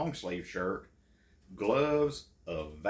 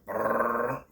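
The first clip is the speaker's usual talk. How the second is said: engine rumble; 4 semitones higher, 7 dB louder than usual. Retold or imitated. Four-wheeler engine noise.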